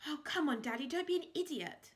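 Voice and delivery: put-on high, loud exasperated girl; impatient girl. A narrator's reading. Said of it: "said Daisy".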